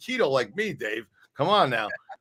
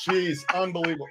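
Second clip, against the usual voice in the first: ordinary delivery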